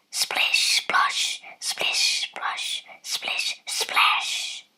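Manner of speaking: hushed, voiceless water sounds; whispered splashes. A narrator's reading. Narrator as ducklings jump into the pond, whispered sound effects. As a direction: whisper each splash separately.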